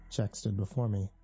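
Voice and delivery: ordinary delivery, nothing marked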